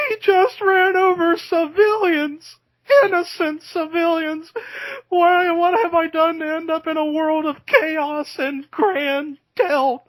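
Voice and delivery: high, loud, anguished wail; Marco Rose impression. Imitating Marco's commentary on the ambulance crash, hammed-up high wail. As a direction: cry out in mock horror at the chaos. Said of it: "he would be like".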